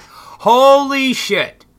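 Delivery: high, drawn-out loud exclamation; loud shock. Acted. Reacting to news of a suicide, loud shock. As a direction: stretch it out at full volume, aghast.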